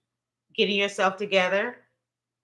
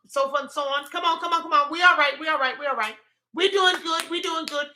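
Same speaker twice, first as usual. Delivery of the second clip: higher, brisk chanted reassurance; rallying pep talk; 4 semitones higher, 4 dB louder than usual. Real, not acted. Speaker pulling self and followers together, rallying. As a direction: shake off the tears and rally everyone.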